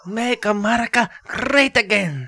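high, loud, slow put-on Trump; Trump impression. Showing how he mocks Trump to his boss, loud slow. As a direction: drag out make America great again. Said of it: "I'm like".

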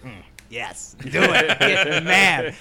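high, loud gleeful taunt; gleeful egging on. Retold or imitated. Foreman cheering on the confrontation, loud gleeful taunt. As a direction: egg him on with glee. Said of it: "And I was like".

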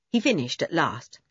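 plain reading voice

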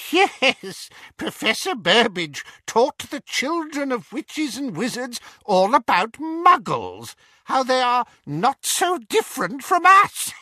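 high, cold, mocking villain voice; cold dark lord. Dark lord mocking the Death Eaters, cold and sneering.

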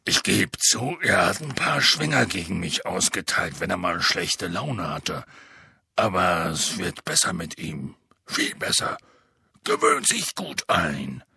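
deep rough half-giant voice; low booming gamekeeper; Hagrid voice. Acted. Hagrid defends Grawp.

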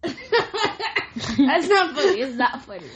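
giggling through the words; trying not to laugh. Real, not acted. Child laughing while denying.

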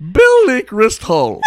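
high, slow, hammed-up fake accent on "Bill Leak Wrist Hull"; terrible accent. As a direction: stretch it out in a silly bad accent. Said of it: "if you say it like that".

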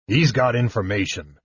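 a hint of a deep warrior voice; lightly voiced big warrior. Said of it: "Caramon said in his deep voice".

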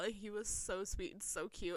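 ordinary talk

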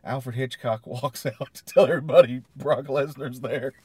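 real laughter breaking through the words; laughing while reading. Real, not acted. Cohost reads a funny note aloud, laughing. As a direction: read it out wobbling with laughter.

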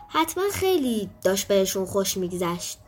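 usual speaking voice

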